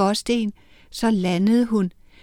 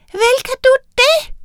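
much higher, tiny piping voices; tiny witches' voice; 5 dB louder than usual. Acted. The little witches reassuring Miranda, high and tiny.

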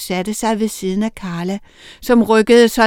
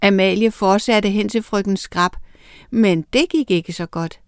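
ordinary delivery, nothing marked

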